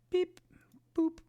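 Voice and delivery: squeaky high robot beeps; high beep boop. Pretend. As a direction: chirp it like a machine. Mimicking a device beeping.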